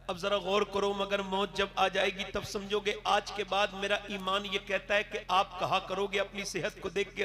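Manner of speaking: ordinary delivery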